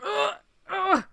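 high, loud groans of revulsion; mock-gag a loud "ugh" in disgust. Recalling her reaction to textbook phrasing, disgusted groan.

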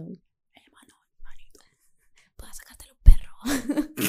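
whispering street-guy ASMR; whispered ASMR. Pretend. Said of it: "hicieran como una A S R M pero de chacalito".

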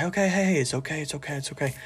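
put-on soft, hushed soothing of a panicked child; hushed repeated it's okay, calming the family; calming reassurance. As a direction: settle them down gently, quietly. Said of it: "trying to be the calm".

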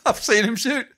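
relishing the punchline a second time; laughing repeat. Retold or imitated. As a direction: savour it again, high and amused.

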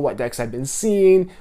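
ordinary talk, nothing marked

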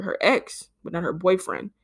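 ordinary delivery

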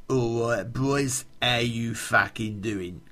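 a hint of a rough local hardman; lightly imitating a cocky mate's drawn-out greeting; Mad Mick's greeting. Greeting the boys in a lightly imitated Mick voice. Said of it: "'Cause that's the way he spoke".

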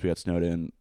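ordinary talk

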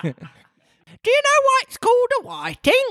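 very high squeaky question; Griffin. A narrator's reading. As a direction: pipe the riddle up high. Griffin asks riddle.

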